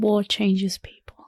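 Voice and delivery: slow, whispered film-trailer solemnity; mock-grave whisper. Co-host explaining the hero's change, mock-grave whisper. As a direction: whisper it slowly for effect.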